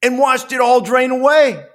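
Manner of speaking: excited storyteller build-up; dramatic play-by-play. Acted. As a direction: call it like a late-game broadcast. Dramatic game recap.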